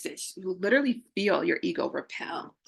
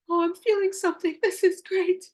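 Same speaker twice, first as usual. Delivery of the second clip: much higher, thrilled new voice; excited discovery; 11 semitones higher than usual. Retold or imitated. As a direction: burst with delight at feeling again. Delight at feeling emotions again.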